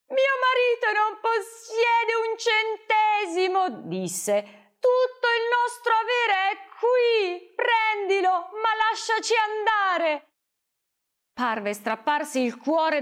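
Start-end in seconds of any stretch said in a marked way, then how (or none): 0.1-3.9 much higher, weeping mother; begging through tears; crying, pleading voice; tearful plea. Acted. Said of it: "La madre piangeva". 4.8-10.3 much higher, sobbing as she begs; weeping mother offering everything; tearful begging; crying plea. Acted. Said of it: "La madre piangeva".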